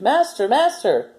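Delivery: high, slow, alarmed cry; the harp crying out to wake the giant, alarmed; screamed. A narrator's reading. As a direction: call out in panic to wake him. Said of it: "she screamed".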